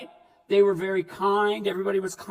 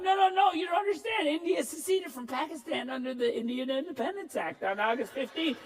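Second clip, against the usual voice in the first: frantic correction; 4 semitones higher than usual. Retold or imitated. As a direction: insist hard, like a kid correcting bullies. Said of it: "I'm like".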